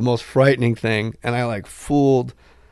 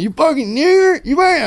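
much higher, louder mock thug shouting; retold fighter yelling in a put-on voice; angry gangbanger; 5 dB louder than usual. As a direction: ham up a raging street fighter mid-fight. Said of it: "And he kept going".

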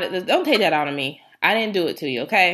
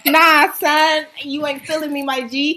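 lightly done New York slang voice, higher and louder; put-on New York accent; 8 semitones higher, 8 dB louder, slower than usual. She fakes a New York voice for her friends, lightly. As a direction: play a wannabe Brooklyn girl, lightly New York. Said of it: "you gonna hear that accent".

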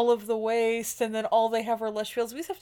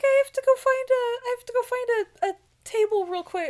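much higher, tearful parody; mock heroine voice; 10 semitones higher than usual. Parodying the heroine leaving Jack, much higher tearful voice. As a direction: play the weepy heroine.

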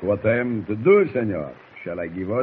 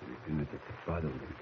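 fast, soft whisper; hushed whisper; 7 semitones lower, 18 dB quieter, faster than usual. Acted. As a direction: whisper so he won't wake. Sneaking into a sleeping boy's cabin.

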